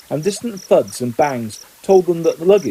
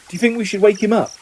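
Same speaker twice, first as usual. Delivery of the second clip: awkward; 7 semitones higher, faster than usual. A narrator's reading. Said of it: "Ron asked awkwardly".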